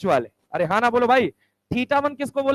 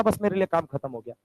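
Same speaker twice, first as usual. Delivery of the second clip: ordinary delivery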